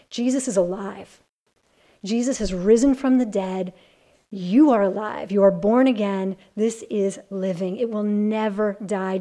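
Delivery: rising to a loud, ringing proclamation; preaching at full force. Acted. Preacher proclaiming the living hope to the women's study, full force.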